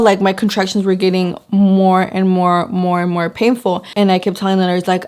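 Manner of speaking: normal talking voice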